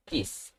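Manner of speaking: "kiss" with the K relaxed toward a G; soft, unforced K in "kiss". Shown as a demonstration. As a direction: start "kiss" on a sound between G and K. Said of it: "Somewhat between G and K".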